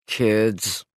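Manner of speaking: mild fatherly caution; warning. A narrator's reading. Dad cuts short the bickering, warning. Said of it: "Dad warned".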